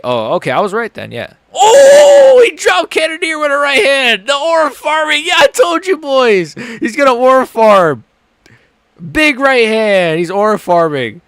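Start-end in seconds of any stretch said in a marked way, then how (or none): 1.5-11.2 screaming high and loud at the knockout; real excited yell. Real, not acted. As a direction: erupt in hype as your fighter drops him. Knockout while watching the fight live.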